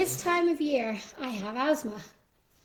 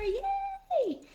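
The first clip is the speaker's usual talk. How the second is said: excited cheer. Real, not acted. Cheering herself for showing up.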